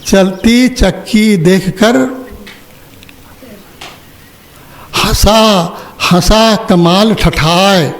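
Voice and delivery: slow, drawn-out couplet with pauses; verse recitation. Retold or imitated. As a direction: recite the verse slowly, stretching the words. Quoting a verse to listeners. (धीमे, खींचकर पद-पाठ)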